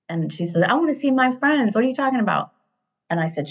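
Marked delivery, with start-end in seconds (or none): 0.6-2.5 put-on raised-pitch teen complaint; imitated furious daughter. Daughter furious at mom over leaving friends, imitated complaint. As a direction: mimic an angry daughter, voice pitched up. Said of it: "She's so mad, furious".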